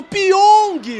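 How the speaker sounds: high, loud exclamation; excited shout. Real, not acted. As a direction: burst out the name in surprise. Exclaims at acrobatic attempt. (grito empolgado)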